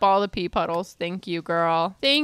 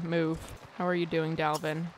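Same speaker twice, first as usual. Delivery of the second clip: ordinary talk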